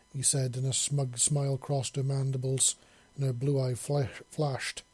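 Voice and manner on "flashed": ordinary delivery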